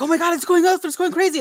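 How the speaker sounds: high, loud friends freaking out; mock excitement. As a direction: imitate thrilled amateur ghost hunters. Mimics friends excited over meter.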